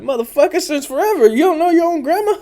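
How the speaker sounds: incredulous cousins. Retold or imitated. Cousins mocking him for not knowing grandma, incredulous squeal. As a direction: squeal it like you can't believe he didn't know. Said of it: "They're like".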